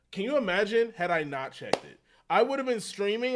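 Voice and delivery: like you just found out the stream died; exasperated disbelief. Real, not acted. Ranting about the lost stream.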